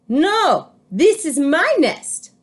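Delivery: high, loud, slow protest; a bird refusing to budge; chicken claiming nest. A narrator's reading. Chicken shouts at duck, high and loud.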